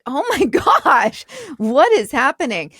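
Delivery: high, loud retold exclamation; exasperated disbelief. Mother reacts to daughter's failed whispering, exasperated.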